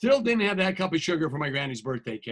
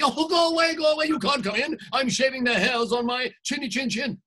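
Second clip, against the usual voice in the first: pig yelling back; 6 semitones higher than usual. Acted. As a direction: shoo the wolf off in a high panic. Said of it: "he yelled back at me".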